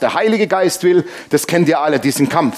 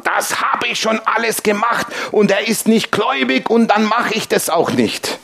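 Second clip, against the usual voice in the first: high-pitched, indignant mock outburst; imitated snapping; 6 semitones higher than usual. Woman cuts [name] off, imitated hissy snapping. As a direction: snap back like an offended woman, imitated. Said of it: "Fauchte sie mich schon an".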